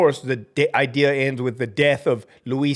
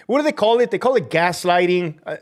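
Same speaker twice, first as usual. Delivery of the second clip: ordinary delivery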